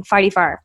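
"Farty far", ah in both words; Saint Louis accent. Retold or imitated. Local naming the highway, "Farty far" with ah vowels. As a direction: answer forty-four as "Farty far". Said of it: "the Saint Louis farty far".

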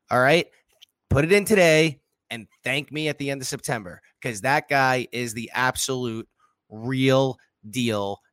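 ordinary delivery

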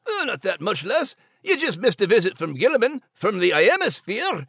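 Scottish brogue, easygoing; relaxed Scottish lilt; Scottish dog. Acted. Max the dog fills Nigel in, Scottish brogue.

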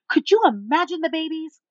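high, gushing mimicry; imitating a delighted, over-eager stranger; loud, animated. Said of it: "And she's loud".

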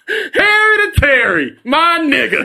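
high, hysterical laughing holler; laughing shout. Real, not acted. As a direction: shout it through helpless laughter. Shouting the mangled word in hysterics.

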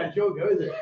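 ordinary talk, nothing marked

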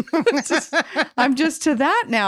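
ordinary talk

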